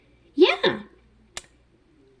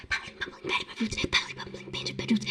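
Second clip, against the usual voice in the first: softer, breathy whispered tongue twister; whispering; 3 semitones lower, 13 dB quieter than usual. Shown as a demonstration. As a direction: whisper it under your breath. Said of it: "I was whispering it".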